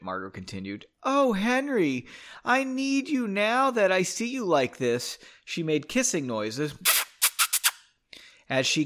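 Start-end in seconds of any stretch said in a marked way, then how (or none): 1.0-5.2 mock swooning. A narrator's reading. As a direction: swoon over him in jest, pitched high. Mock swooning tease in story.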